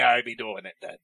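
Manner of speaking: pirate voice. Pretend. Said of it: "should I be telling that in the pirate voice".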